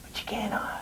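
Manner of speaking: eerie whisper; whispered promo line. Pretend. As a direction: hiss the last threat softly. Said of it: "Do it".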